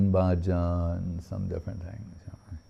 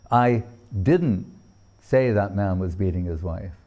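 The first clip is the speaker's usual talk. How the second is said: "didn't" set apart and falling; stress on DIDN'T; 3 semitones lower than usual. Shown as a demonstration. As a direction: punch "didn't", then let the rest fall. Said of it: "According to where the emphasis is placed".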